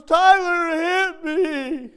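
put-on high, slow, tearful wail of a small boy; crying little boy. Little grandson running to his dad, high and wailing. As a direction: wail it like a hurt toddler. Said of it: "He goes".

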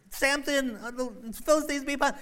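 high, loud shout of warning; alarmed cry. Acted. Delilah shouting the alarm to Samson. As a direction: sound the alarm.

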